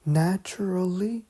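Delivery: ordinary delivery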